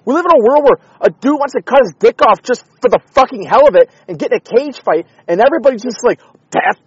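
loud, high, furious ranting; angry rant. Real, not acted. Fed-up podcaster ranting to his listeners, shouting angrily.

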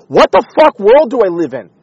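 loud, high, furious ranting; angry rant. Real, not acted. Fed-up podcaster ranting to his listeners, shouting angrily.

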